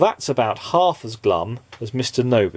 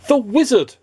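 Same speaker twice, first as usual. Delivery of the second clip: high, louder eureka cry; excited cry; 13 semitones higher, 5 dB louder than usual. Acted. Mister Happy hitting on the idea, high excited cry. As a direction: burst out with the idea. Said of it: "cried Mister Happy".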